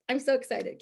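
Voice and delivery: usual speaking voice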